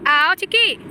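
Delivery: calling out. Real, not acted. Mother beckons a reluctant toddler at the train, calling out.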